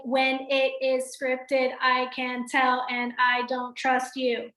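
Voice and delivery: slow, stiff, recited word by word; stiff scripted delivery. Shown as a demonstration. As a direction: sound like you're reading off notes. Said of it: "what if I talked like that".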